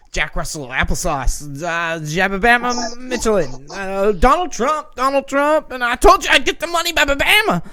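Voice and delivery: high, loud nonsensical ranting; crazy crackhead. Retold or imitated. Imitating a rambling bus passenger on her phone, crazed ranting. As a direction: babble wildly, climbing to a high-pitched yell. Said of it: "crazy crackhead was on the bus".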